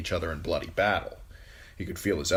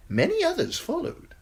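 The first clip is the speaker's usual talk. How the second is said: high eunuch voice, lingering on Many; high sly Varys; 4 dB louder than usual. Acted. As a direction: Varys the eunuch slyly reporting defections.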